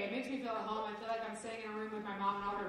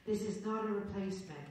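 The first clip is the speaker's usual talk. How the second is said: soothing British voice; 4 semitones lower than usual. Retold or imitated. Audiobook narrator reading her a disclaimer, light soothing British voice. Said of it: "this very soothing British voice".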